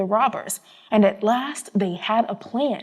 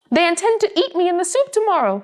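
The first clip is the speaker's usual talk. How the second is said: a raised, higher cry of a scared rooster; frightened cry; 7 semitones higher than usual. A narrator's reading. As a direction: cry it out, afraid of the soup pot. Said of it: "he cried".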